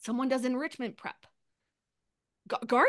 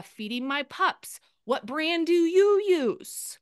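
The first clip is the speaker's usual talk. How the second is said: slow, stretched question, pushing higher and louder at the end; drawn-out sample post; 4 semitones higher, 5 dB louder, slower than usual. Pretend. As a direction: draw it out slowly to hook followers.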